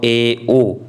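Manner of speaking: ए and ओ said low and deliberate; slow demo of ए, ओ; vowel demo. Shown as a demonstration. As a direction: sound ए, ओ with the mouth half closed. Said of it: "मुख आधा बंद रहता हो".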